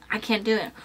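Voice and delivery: ordinary talk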